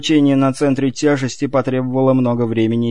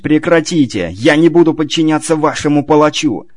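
shouting; 5 semitones higher, 6 dB louder than usual. Acted. Ivar resisting his captors.

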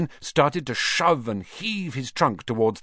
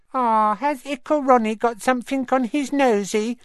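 high cooing mock baby voice; teasing baby talk; 13 semitones higher, 3 dB louder than usual. A narrator's reading. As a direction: tease him like a toddler. Twin teases little brother.

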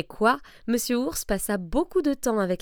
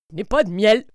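loud curt bear brushing off the rabbit; a grumpy bear snapping no; gruff bear reply; 9 dB louder than usual. Acted. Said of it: "répliqua monsieur Ours".

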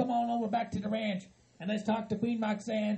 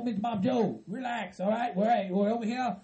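ordinary delivery

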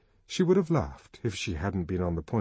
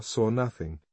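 usual speaking voice

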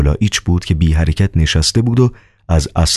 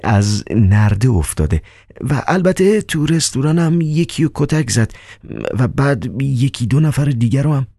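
nervous Riukhin; 8 semitones higher than usual. Acted. Riukhin's high voice.

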